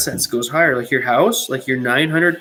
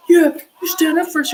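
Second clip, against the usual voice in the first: higher, louder, mock gushing crowd; mock cheering fan; 9 semitones higher, 4 dB louder than usual. Mocking Canadians who cheer Trudeau, gushing high voice. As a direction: gush like a starry-eyed supporter. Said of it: "And everyone's like".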